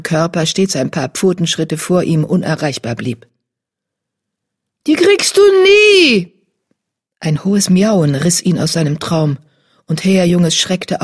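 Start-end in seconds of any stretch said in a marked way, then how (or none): 4.9-6.3 much higher and louder kitten taunt, slowed; high, loud teasing call. A narrator's reading. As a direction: tease him from far ahead in the dream.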